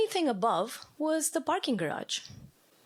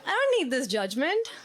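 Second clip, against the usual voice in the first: mock offended; 8 semitones higher than usual. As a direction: play it as put out, laughing at yourself. Offended reply to the trapped man.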